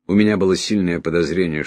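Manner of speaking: ordinary delivery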